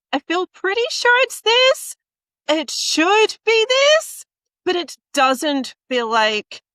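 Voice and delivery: high, hesitant voice doubting itself; uncertain, questioning. Shown as a demonstration. Host imitating a speaker who doubts her point, high and unsure. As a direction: sound unsure of your own point. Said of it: "It feels like you are questioning your own point".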